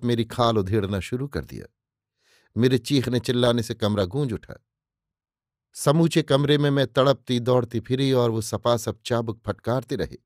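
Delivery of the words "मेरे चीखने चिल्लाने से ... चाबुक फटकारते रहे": ordinary delivery, nothing marked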